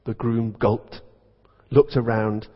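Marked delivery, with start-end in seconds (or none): none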